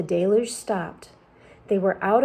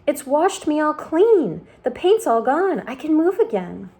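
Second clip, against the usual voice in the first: overjoyed; 7 semitones higher, 5 dB louder than usual. A narrator's reading. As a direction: revel in being free. Centipede overjoyed.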